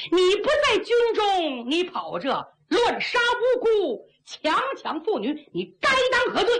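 high, forceful accusation with stretched words; stern rebuke. Acted. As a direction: demand an answer for the crime. Commander rebukes a murderous officer. (厉声斥责)

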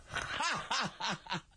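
big slow official's guffaw; generous official laughing; hearty laugh. Acted. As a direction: laugh broadly, pleased.

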